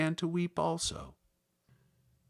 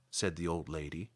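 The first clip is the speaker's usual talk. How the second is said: ordinary delivery, nothing marked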